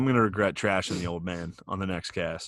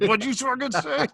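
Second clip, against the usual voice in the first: much higher mock outraged dad; imitating dad. Son mimics dad reacting to being cursed at, high-pitched. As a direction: squeak out the dad's indignant comeback. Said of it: "and he's like".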